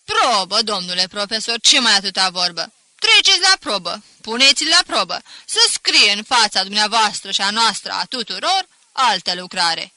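loud, gruff demanding shout; shout in a deep voice. Acted. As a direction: bellow it, calling for a test. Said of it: "strigă cu glas gros".

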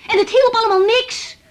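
high, loud, indignant protest; defensive high-pitched outcry; indignant outburst. Acted. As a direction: fire back, offended. Heated protest against superior.

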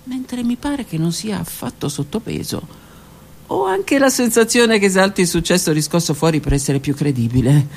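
plain reading voice

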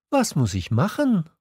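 high, questioning prince voice; little prince. A narrator's reading. Little prince asking the fox, high questioning voice.

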